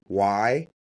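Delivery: usual speaking voice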